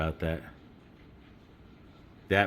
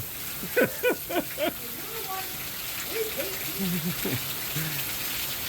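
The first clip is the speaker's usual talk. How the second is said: high nervous laugh; 6 dB quieter than usual. Real, not acted. Nervous release after panic.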